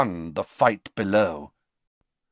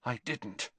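much softer, barely voiced; breathed; 3 semitones higher, 13 dB quieter than usual. Malfoy to Dumbledore, a fearful breathed denial. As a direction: breathe it out in fear. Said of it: "breathed Malfoy".

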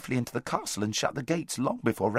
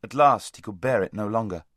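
ordinary delivery, nothing marked

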